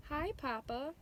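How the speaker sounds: muffled, face hidden under paws. A narrator's reading. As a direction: speak from under your paws. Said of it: "he said in a muffled voice".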